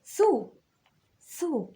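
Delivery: high, clear "ثو" as thu; teacher voicing "ثو" for the pupils; th with damma. Shown as a demonstration. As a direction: say "ثو" with rounded lips, thu. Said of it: "طب والضمة؟".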